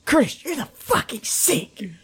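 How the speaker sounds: high, drawn-out strained squeal; mock shocked outburst. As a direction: imitate her scandalised cry, stretched out. Retelling Keely's shocked outburst.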